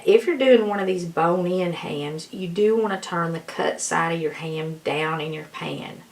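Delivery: usual speaking voice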